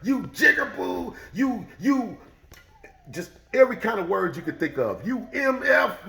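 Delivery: hurling slurs. Retold or imitated. The old man shouts slurs while being punched. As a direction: spit the slurs between blows. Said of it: "he's saying a racial slur".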